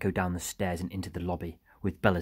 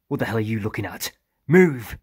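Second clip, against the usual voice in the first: angry order building to a loud, higher shout; 4 semitones higher, 9 dB louder than usual. A narrator's reading. Harper orders Iram to get moving, shouting. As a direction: snap the question, then yell the last word. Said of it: "shouted Harper at Iram".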